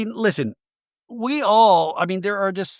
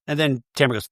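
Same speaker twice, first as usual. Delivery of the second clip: ordinary talk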